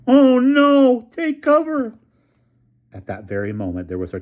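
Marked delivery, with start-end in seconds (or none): 0.1-1.9 very high, louder panicked cry; half-crazed scream. A narrator's reading. Elmer screaming a warning before the explosion. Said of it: "Elmer's half-crazed scream".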